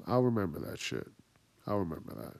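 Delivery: low, soft, retold vow; softly nursing a grudge; quiet grudge. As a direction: drop down into a muttered grudge. Retells vowing grudge after spoiler.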